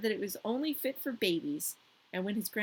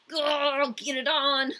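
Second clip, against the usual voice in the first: sassy diva; 6 semitones higher, 10 dB louder, much slower than usual. Retold or imitated. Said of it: "She was just like".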